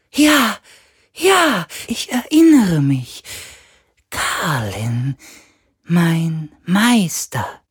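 high, drawn-out spirit voice; an ancient sea ghost remembering. A narrator's reading.

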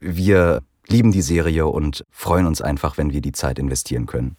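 ordinary talk, nothing marked

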